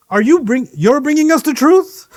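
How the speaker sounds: high, loud incredulous question; mocking disbelief. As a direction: say it as if the idea is absurd. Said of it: "actually sounds like".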